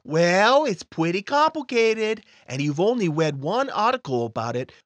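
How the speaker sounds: put-on a smug child explaining; little boy voice. Timmy voice explains.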